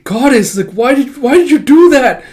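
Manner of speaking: high, loud grief; anguished cry. Acted.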